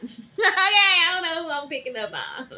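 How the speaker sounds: loud laugh through the words; cracking up, can't hold it together; laughing hard. Real, not acted. Reader breaking into laughter at her own reading.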